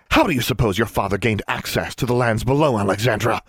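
shouting. A narrator's reading. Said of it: "she shouted into the girl's face".

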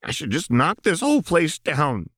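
high, bitter and spiteful; spat out. A narrator's reading. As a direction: spit the words out at her. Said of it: "Jinx spat".

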